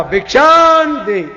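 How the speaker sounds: high, long-drawn call; mendicant's alms call. Acted. Holy man arriving in the village, calling for alms. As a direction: call out for alms, stretching the word.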